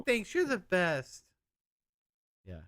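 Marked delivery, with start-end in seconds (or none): 0.0-1.2 high-pitched mock voice. Voicing girlfriend's thanks.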